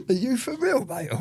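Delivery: mock the detective's daft request; amused disbelief.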